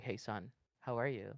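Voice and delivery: low, soft put-on man's voice. Shown as a demonstration. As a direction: drop down into a quiet masculine register. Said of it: "that's the man voice".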